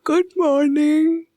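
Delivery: just woken, yawn the greeting; sleepy yawning. A narrator's reading. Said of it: "उबासी लेते हुए अलसाई हुई आवाज में बोली".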